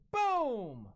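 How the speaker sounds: excited shout; hype shout. Real, not acted. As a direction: punch it out like a hype man. Hype calling a pulled card.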